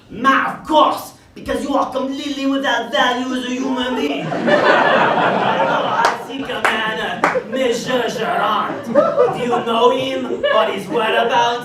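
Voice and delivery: a mock Frenchman looking down his nose, in a French accent. Frenchman demanding Monsieur Gerard, in a hammed-up French accent. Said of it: "I believe he's a French intellectual".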